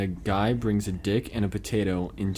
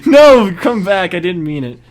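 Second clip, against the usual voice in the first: calling out; 8 semitones higher, 13 dB louder than usual. Real, not acted. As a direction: shout after them to come back. Calling back a friend leaving.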